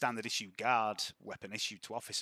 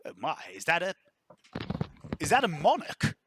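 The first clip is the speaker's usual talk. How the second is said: higher, louder, impressed; gruff general; 5 semitones higher, 7 dB louder than usual. Acted. General spotting a fine firearm, impressed. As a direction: rise on monarch with delight.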